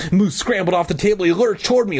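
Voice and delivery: usual speaking voice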